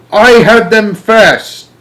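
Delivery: put-on slow, high, loud old woman's shout; greedy grandma screeching. A narrator's reading. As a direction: screech it possessively. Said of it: "Grandma Georgina was shouting".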